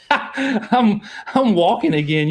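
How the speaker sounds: high laughing voice. Real, not acted. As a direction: laugh through the words. Laughing at himself.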